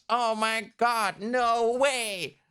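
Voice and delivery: can't believe what she's reading; excited disbelief. Real, not acted. Reacting to the bear entrance.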